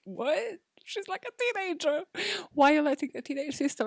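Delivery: retold shock, pitched high. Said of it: "I was like".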